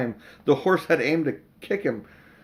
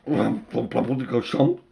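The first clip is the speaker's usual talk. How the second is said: muffled voice; slower than usual. Acted. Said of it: "voice from inside his hat".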